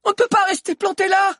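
very high, loud panicked shout; high-pitched alarmed cry; urgent yelling. A narrator's reading. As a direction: yell to get everyone moving. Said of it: "hurla Lunella".

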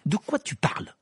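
sharp and clipped, pitched high; curt reply. A narrator's reading. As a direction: cut him off dryly. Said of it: "répondit sèchement Ron".

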